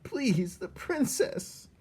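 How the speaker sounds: high, pleading sob-like voice; tearful plea. Acted. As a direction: beg through tears. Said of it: "he was crying now".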